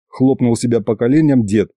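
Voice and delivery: usual speaking voice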